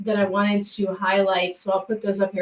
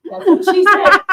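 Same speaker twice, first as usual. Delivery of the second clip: laughing punchline; 7 semitones higher, 8 dB louder than usual. Real, not acted. Punchline joke.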